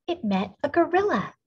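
plain reading voice

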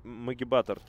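ordinary talk, nothing marked

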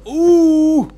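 loud, high-pitched "ooh"; high whoop. Real, not acted. Player reacting to a long throw, high loud whoop. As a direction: let out a sudden piercing whoop.

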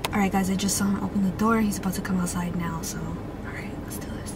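quick hushed words to viewers; hushed aside. Real, not acted. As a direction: hurry the camera aside before he returns. Hiding intro from returning boyfriend.

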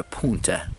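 "punta", local way of saying pound, with an oo vowel; local pronunciation. Shown as a demonstration. Showing how locals say pound, "punta" with an oo vowel. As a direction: say "punta" with oo in place of ow. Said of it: "That's how they pronounce".

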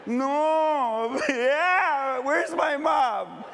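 put-on high wail of a frightened little boy, slowing at the end; crying child. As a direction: call out for mom like a lost, scared child. Boy crying out for his mother.

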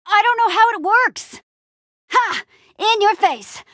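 high, triumphant crowing; excited in-your-face taunt; gloating. Acted. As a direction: crow over a surprise win. Gleeful gloating mid-fight.

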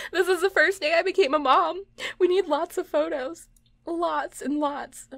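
high, giddy new-mom gushing; gushing excitement. Real, not acted. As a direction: gush with pitch way up. Gushes over first day as mom.

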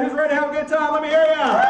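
loud call to the audience; rally the room, shouting; crowd hype shout. Real, not acted. Host rallies the audience to cheer, shouting.

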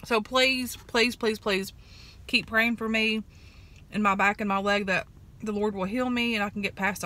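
eating between words; chewing while talking. Real, not acted. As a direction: mouth partly full of pastry. Seen 3: Holds pastry, lips pressed and chewing between phrases while talking.

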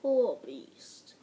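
normal talking voice

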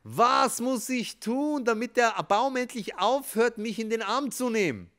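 high, loud, slow desperate cry; shouting. Acted. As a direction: yell it out while hugging the tree. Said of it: "und schreit".

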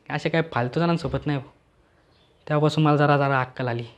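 usual speaking voice

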